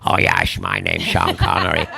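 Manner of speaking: deep comic Scottish impression; Sean Connery. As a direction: drop right down into a mock Scottish star voice. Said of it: "so Sean Connery, when he was playing a Russian".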